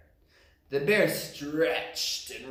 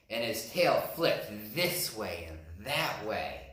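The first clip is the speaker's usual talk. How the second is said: ordinary delivery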